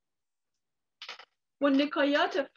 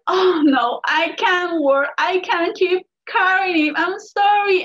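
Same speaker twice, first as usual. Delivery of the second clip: distressed coyote; 4 semitones higher, 7 dB louder than usual. Acted. Coyote exhausted under heavy rock.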